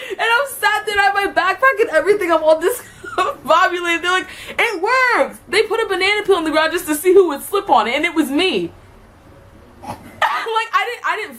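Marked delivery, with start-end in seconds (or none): 4.6-5.4 mock triumphant cheer. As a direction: crow like pranksters whose trap worked. Seniors cheering the prank.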